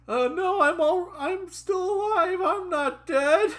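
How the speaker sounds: very high, comic falsetto protest; squeaky character voice. Acted. Reader joking as the story's trainee, squeaky falsetto.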